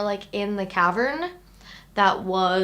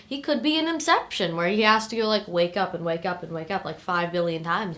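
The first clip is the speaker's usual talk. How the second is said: ordinary talk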